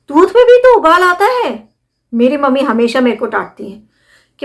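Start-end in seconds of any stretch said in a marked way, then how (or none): 0.1-1.7 playful exclaim. Acted. Playful remark, voice shifts up.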